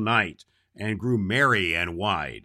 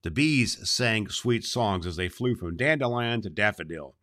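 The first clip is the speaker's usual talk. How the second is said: usual speaking voice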